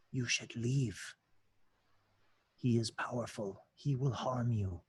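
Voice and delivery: the cowering tiefling whispers a warning to Magnus; whisper in the ear. Acted. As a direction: murmur a secret warning. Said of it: "you hear a whisper in your ear".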